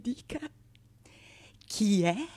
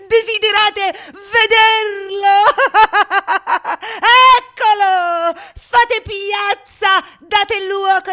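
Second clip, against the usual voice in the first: much higher and much louder, laughing as he yells; manic mocking shout; 12 semitones higher, 13 dB louder than usual. Prologue crying out to the crowd to make room, laughing wildly. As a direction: clear the way for the horned fool, bellowing with glee. Prologue unveils the horned fool.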